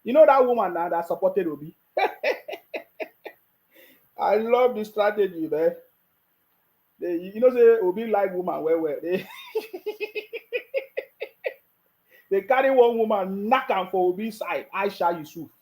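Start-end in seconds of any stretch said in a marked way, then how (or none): 2.0-3.3 a high breathy burst of laughter; high airy laugh. Real, not acted. Laughs at the Aisha voice clip. 9.3-11.5 a long high rising laugh. Real, not acted. Laughs about the strategy.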